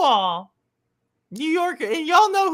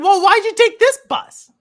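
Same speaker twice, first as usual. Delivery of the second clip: retold yelling; 7 semitones higher, 10 dB louder than usual. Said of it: "like, yelling at me".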